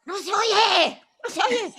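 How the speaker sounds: high, loud heckling yell; yelled heckle. Retold or imitated. Elderly man in the audience yelling he can't hear, high and loud. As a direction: shout it from the back row. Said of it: "he would yell out".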